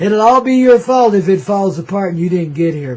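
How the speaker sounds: loud, laughing mock scolding; jokingly blaming listeners while laughing; laughing mock blame. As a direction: scold them through laughter.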